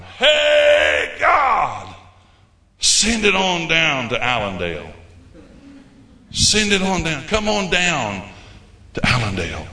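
a hammed-up call from Allendale to God. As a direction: shout the plea across the miles. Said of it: "I hear you out there going".

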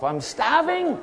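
high-pitched comic cry; starving outburst. Retold or imitated. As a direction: leap up high in mock desperation from hunger. Acted outburst of sudden hunger.

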